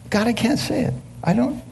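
high, soft strained plea; pleading with God. Retold or imitated. Preacher retelling his plea to God, high and pleading. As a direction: beg God in a tight high voice.

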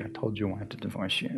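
fading echo. Retold or imitated. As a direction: let the memory fade out. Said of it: "kept hearing my mom saying".

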